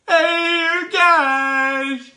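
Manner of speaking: loud high shout across the room; hey, you guys, called out loud; calling out. Real, not acted. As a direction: yell to get everyone's attention. Someone calls out to the group.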